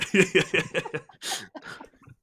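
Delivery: loud, high burst of laughter; real, tickled laughter; amused laugh. Real, not acted. Amused at fake accent idea.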